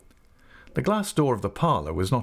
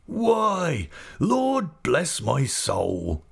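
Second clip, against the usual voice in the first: put-on old man startled, drawn-out cry; lightly voiced astonished old man; surprised exclamation; 6 semitones higher, much slower than usual. A narrator's reading. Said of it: "exclaimed Mister Omer".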